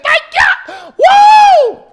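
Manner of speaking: high, loud praise shout and whoop; church mother getting happy. Retold or imitated. Church mother getting happy as the pastor talks, shrieked. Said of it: "one of the mothers got happy".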